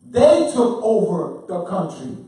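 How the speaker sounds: loud, slow, hammered statement; emphatic declaration. Real, not acted. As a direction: declare it slowly at full force.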